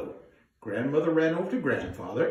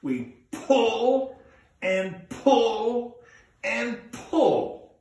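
higher, louder straining pulls; harder pull; 8 semitones higher, 3 dB louder, much slower than usual. Shown as a demonstration. Storyteller urging kids to pull harder. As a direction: really heave on each pull. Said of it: "Let's try it one more time".

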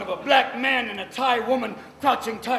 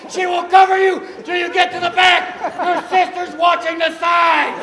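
much higher, much louder drill-sergeant commands; military mom barking orders; 11 semitones higher, 16 dB louder than usual. Pretend. Mom ordering the kids into the store like troops, hammed-up screamed commands. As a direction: command the family like a platoon under fire.

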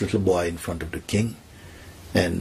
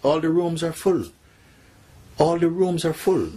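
high-pitched, distressed report to a king; alarmed minister; 10 semitones higher than usual. Acted. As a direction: plead helplessly in a raised pitch.

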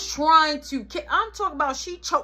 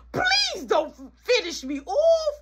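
slow, high drawn-out plea; mock pleading; 6 semitones higher, slower than usual. Kid threatened by mama, hammed-up slow plea. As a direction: beg comically, stretching the words.

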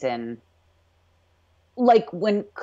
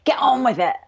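higher-pitched attempt at Scots; second clumsy stab at a Scottish accent; bad Scottish accent; 7 semitones higher than usual. Fan retrying the prisoner's retort, bad Scottish accent. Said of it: "that was the worst accent ever".

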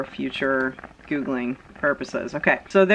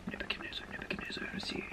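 barely audible muttering to oneself; searching whisper; 21 dB quieter, much faster than usual. Pretend. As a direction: whisper while hunting through a book. Acting him searching his Bible.

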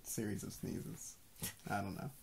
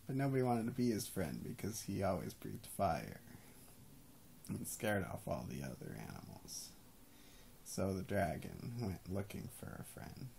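soft, lower bedtime storyteller; slipping into a lower storytelling voice; story narration; 4 semitones lower, 3 dB quieter than usual. Acted. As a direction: tell it like a bedtime tale, lower and gentle.

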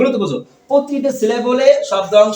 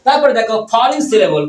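ordinary talk

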